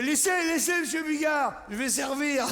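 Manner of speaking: a hint of a panicked hostess rushing in; flustered hostess. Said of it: "Elle fait".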